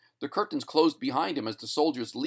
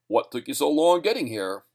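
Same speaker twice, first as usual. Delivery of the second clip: louder, projected question; stage announcer; 3 semitones higher, 5 dB louder than usual. A narrator's reading. Announcer feeding Spud a question, projected. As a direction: pitch it out to the audience.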